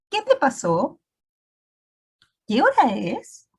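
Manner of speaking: "¿Qué te pasó? ¿Qué hora es?" read as clear questions with a rising tune; question intonation. Shown as a demonstration. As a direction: ask "¿Qué hora es?" slowly, like a real question. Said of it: "debo leer en tono de pregunta".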